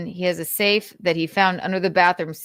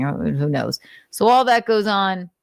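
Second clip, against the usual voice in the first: ordinary delivery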